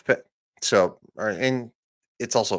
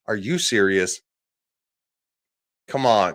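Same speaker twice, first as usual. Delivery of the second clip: ordinary talk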